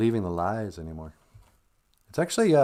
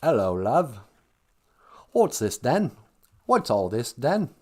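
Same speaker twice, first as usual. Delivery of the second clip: hammed-up British accent, imitating; mock English accent; slower than usual. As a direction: play a comic English local. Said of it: "They have the English accent".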